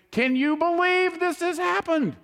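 high, exasperated gossip to a friend; mock indignant complaint. Complaining to a friend about a coworker, high and indignant. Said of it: "go talk to a friend of mine and say".

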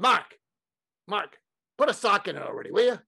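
put-on high, impatient Brooklyn accent; Larry King. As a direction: cut off the fretting in Brooklyn accent. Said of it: "in his Brooklyn accent".

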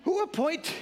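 high-pitched incredulous question; shocked Jesus. Retold or imitated. Jesus answering the man's demand, shocked question. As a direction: ask it high, in mock shock. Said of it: "That's my Jesus shocked voice".

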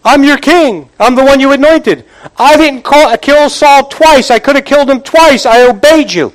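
hammed-up wail to God, high-pitched; tearful lament. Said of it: "In his crying".

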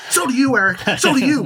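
high sneering taunt; mocking high voice. Mocking quote of the villain.

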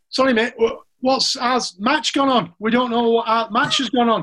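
playfully yelling to passing fans; retold shout at a car window; mock shout. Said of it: "Tufty's shouting to him".

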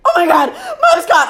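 loud, very high mock panic; panicked sister. Sister realizing mom is gone, mock panicked cry. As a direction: cry out in exaggerated alarm. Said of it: "Claire all of a sudden realizes".

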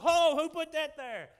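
loud, high, slow pleased surprise; mock surprise. Preacher acting a walker who trips over happiness, surprised. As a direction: stumble on something wonderful, delighted.

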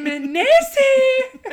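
high, loud, drawn-out mock Scottish; Scottish accent. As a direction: stretch it out in a hammed-up Scottish accent. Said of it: "That's my Scottish accent".

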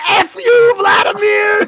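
very high, loud mock holler; shouted insult. Downed pilot curses his enemy, hollering. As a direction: hurl it at someone far away. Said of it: "He goes".

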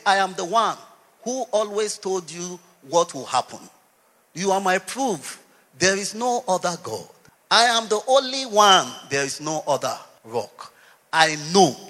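loud, declaring as God Himself; thundering scripture reading; booming proclamation. Acted. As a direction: proclaim each line with full force. Preacher voices God's words.

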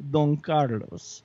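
"Don Carlos" slow and grand, Spanish-style, falling at the end; drawn-out Spanish-style name. Shown as a demonstration. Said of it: "you've gotta say it like that".

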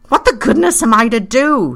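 high, loud exasperated outcry; burst of passion. A narrator's reading. Pyecraft despairs to his friend, passionate outburst. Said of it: "gave way to a sudden burst of passion".

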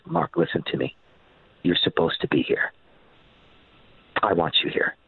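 low, hushed, sincere quote; tender reassurance. Retold or imitated. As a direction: reassure a friend gently, with long pauses. Retells tender reassurance, face held.

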